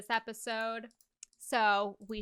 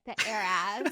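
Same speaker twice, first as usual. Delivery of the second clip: light mock socialite pronunciation; lightly put-on heiress voice with a laugh; slower than usual. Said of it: "how would [name] say it".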